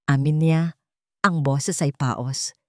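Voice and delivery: plain reading voice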